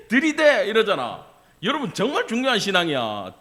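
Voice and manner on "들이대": high, loud comic catchphrase. Retold or imitated. Preacher imitating a singer's catchphrase for laughs, loud impression. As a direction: belt it out as a mock celebrity impression. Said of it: "[name] 씨 유행어가 뭐예요?".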